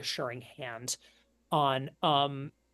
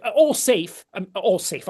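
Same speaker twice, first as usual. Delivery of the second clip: hasty reassurance; 4 semitones higher, 11 dB louder than usual. Acted. Lady hastily reassures.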